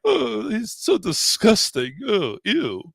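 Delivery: slow, high, hammed-up disgust; mock revulsion at a dirty word. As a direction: act grossed out, drawn out.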